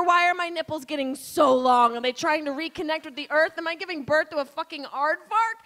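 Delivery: usual speaking voice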